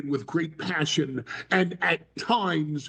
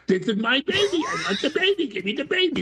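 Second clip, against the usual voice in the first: higher mock voice, half laughing; demanding mother; 7 semitones higher than usual. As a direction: whine for the baby, giggling through it. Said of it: "she goes".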